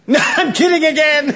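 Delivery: high-pitched laugh through the words; gleeful laughing. Acted. Pursuer retracting joke about hanging, laughing. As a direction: burst out laughing at your prank.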